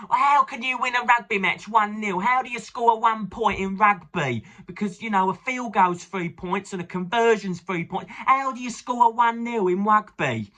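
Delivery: lampoon a baffled southern rugby fan; hammed-up puzzled Southerner asking about rugby; mocking Southerners. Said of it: "all of the Southerners in the south of England saying".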